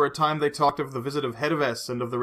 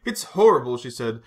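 higher, louder, close to tears; tearful distress; 7 semitones higher, 4 dB louder than usual. A narrator's reading. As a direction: break down over her guilt. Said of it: "Her eyes filled with tears".

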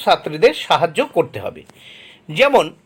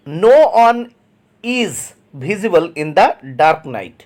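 very slow, word by word; slow dictation reading; much slower than usual. Shown as a demonstration. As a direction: read it out slowly for the class to copy. Teacher reads example sentence aloud. (ধীরে ধীরে শ্রুতলিখনের মতো পড়া)

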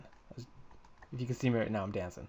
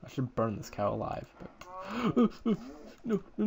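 ordinary talk, nothing marked

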